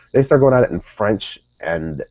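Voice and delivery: ordinary talk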